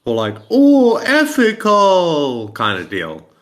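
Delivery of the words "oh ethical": high, loud, drawn-out mock wail; mocking trolls. Imitating trolls making fun of his word, mocking high drawl. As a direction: stretch it out slowly to make fun. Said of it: "the guys that they were".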